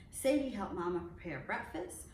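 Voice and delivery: ordinary delivery, nothing marked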